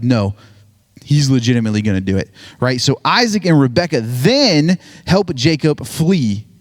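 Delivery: ordinary talk, nothing marked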